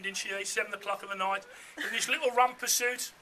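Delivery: ordinary delivery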